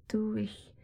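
hushed, hesitant whisper. Acted. Woman whispering hesitantly to her lover. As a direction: barely breathe the words.

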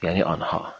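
ordinary delivery

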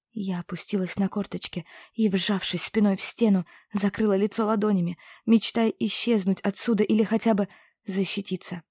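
ordinary delivery, nothing marked

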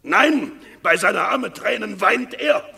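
hoch und laut, immer wieder aufschreiend; zornige Anklagerede. Acted. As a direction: den Verräter vor dem Senat wütend bloßstellen. (Aufidius hetzt die Herren schreiend gegen Martius auf)

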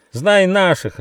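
high, gleeful cheer; joyful shout. A narrator's reading. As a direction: shout it out with glee. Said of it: "радостно крикнул Рыж".

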